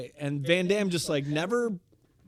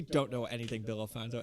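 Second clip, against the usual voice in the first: ordinary talk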